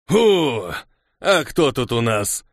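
lightly voiced taunting bandit, drawn out; gloating bandit. A narrator's reading. Bandit leader jumps out at travellers, mock-welcoming taunt. Gang leader springs out on victims.

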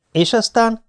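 a high, impatient and then what; nervous question. A narrator's reading. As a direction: press her anxiously for the rest. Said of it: "kérdeztem idegesen". (magas, ideges kérdés)

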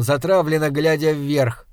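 plain reading voice

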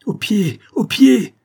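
hurlé très haut et très fort; cri paniqué. A narrator's reading. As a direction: crie l'ordre dans la panique. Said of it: "criai-je à Paula". (le narrateur hurle l'ordre à Paula)

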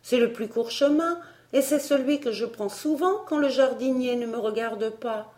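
high, light character voice; young Elsie, explaining. Acted. Elsie explaining the short cut to Barbara, reasoning. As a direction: explain it simply, pitched well up.

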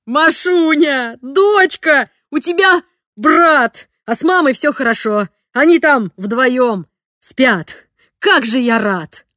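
loud, slow tearful joy, near a shout; father overjoyed telling his daughter the news; joyful laughing. A narrator's reading. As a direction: pour out joy to your daughter. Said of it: "Папа смеялся".

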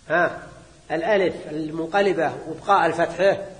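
usual speaking voice

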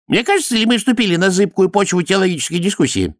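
high-pitched character voice; wary high priest. A narrator's reading. High priest questions the theological discussion, warily.

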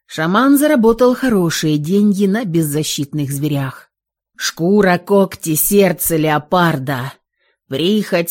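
usual speaking voice

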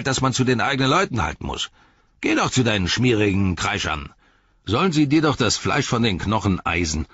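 angry rant, starting high and dropping; screamed orc tirade. Acted. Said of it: "schrie er".

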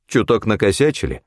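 ordinary delivery, nothing marked

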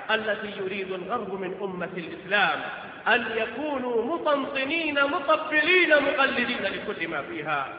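normal talking voice

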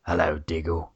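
low, soft London villain greeting; London accent. Acted. Slade greets Diggle as he comes out, London accent. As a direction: greet him in a low Cockney voice. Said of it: "That was the best accent I've heard".

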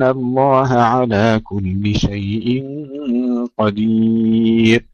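slow melodic chanted recitation; slow tarteel chant; Quran recitation. Acted. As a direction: recite with measured tajweed.

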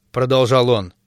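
ordinary delivery

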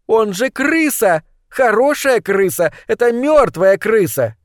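high, emphatic exclamations; indignant insistence. A narrator's reading. Girl indignantly insisting on rat. (возмущённо, высоким голосом)

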